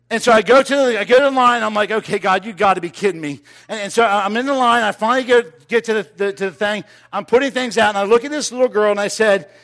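full-throated revival preaching; preaching at full force. Acted. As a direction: drive the story home to the congregation.

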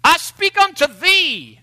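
high, loud, slow and grandiose; booming King James prophet. Retold or imitated. Mocking the angry prophet type, slow booming voice. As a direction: declaim it like a self-important seer. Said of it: "the angry guy who's screaming at us in King James English".

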